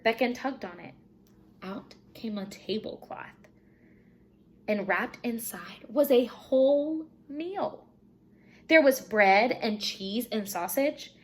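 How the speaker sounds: ordinary delivery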